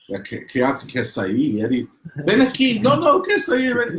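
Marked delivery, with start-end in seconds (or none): 2.2-2.8 higher, louder "Vem aqui"; firm mother call. Retold or imitated. Mother calling her struggling son back, firm and loud. Mother firmly calls child. 2.8-4.0 put-on higher, louder kid's "não, não"; a scared boy begging to get out; protesting child. Little boy protesting to his mother at the doctor's, loud.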